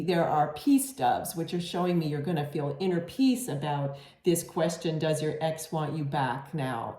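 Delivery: ordinary talk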